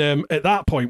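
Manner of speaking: ordinary talk, nothing marked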